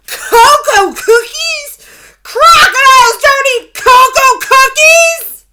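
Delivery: very high, very loud, slow furious crocodile roar; loudest fiercest roar. A narrator's reading. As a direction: blast it with full fury. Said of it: "In the loudest and fiercest voice of all".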